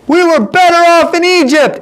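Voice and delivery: high-pitched acted heckler's yell. Man in the crowd to everyone, acted yell. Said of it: "Somebody started yelling out".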